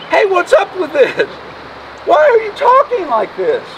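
high-pitched, puzzled challenge; incredulous questioning. Acted. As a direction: question them in mock disbelief. Voices Jesus questioning the disciples.

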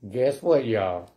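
usual speaking voice

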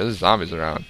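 ordinary delivery

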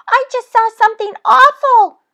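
very high, fast, loud mouse voice; panicked little mouse; crying mouse. A narrator's reading. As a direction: squeak it out fast in a fright. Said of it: "cried the mouse".

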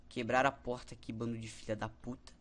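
usual speaking voice

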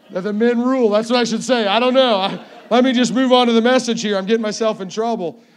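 loud, high joking protest; loud, flustered backtrack. Real, not acted. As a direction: call out over the congregation's laughter. Joking backtrack over congregation's laughter.

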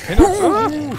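high, loud shrieked warning; screamed warning. Real, not acted.

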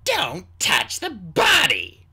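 loud, high, slowly punched order; sharp command. A narrator's reading. Queen warns the Skywing off the body, sharp. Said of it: "the queen said sharply".

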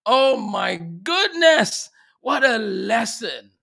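loud, emphatic wonder; amazed exclamation. Real, not acted. Host relishing a viewer's shared lesson, amazed exclamation. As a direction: burst out in awe, stretching the words.

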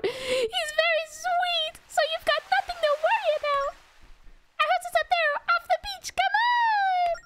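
annoying fairy voice. Acted. Fairy leading someone to grandpa's house, annoying high voice. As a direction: coax them along as a high pesky fairy. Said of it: "I don't wanna talk in this voice anymore".

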